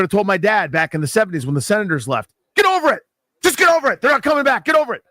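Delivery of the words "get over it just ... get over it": high, loud, impatient brush-off; exasperated telling-off. Pretend. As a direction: snap it at him again and again. Imagined scolding of his dad.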